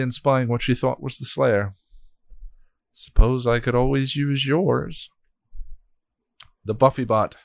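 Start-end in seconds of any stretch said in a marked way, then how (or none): 2.9-5.1 menacing. Acted. God threatens the Slayer.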